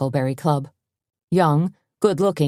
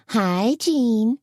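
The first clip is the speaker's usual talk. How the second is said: syrupy; 7 semitones higher than usual. A narrator's reading. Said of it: "in a syrupy voice".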